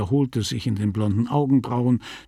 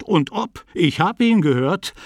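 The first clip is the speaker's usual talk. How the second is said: higher, emphatic and worked up; indignant; 6 semitones higher, 3 dB louder than usual. A narrator's reading. Sick man insisting he heard the preacher, indignant. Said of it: "sagte der Kranke aufgebracht".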